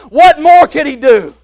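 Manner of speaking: loud, high call of the question; preaching question. Acted. Preacher asking the congregation, loud and ringing. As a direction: fling the question out to the church.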